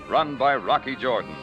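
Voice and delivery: normal talking voice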